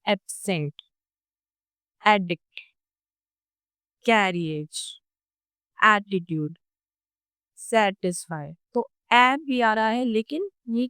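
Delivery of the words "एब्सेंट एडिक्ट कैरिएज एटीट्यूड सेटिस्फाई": careful model words, open flat vowel; example words. Shown as a demonstration. Teacher modelling the open front vowel for students. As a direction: say each word slowly and clearly, mouth wide. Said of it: "ये sound आपको मिलता है कुछ इन words में".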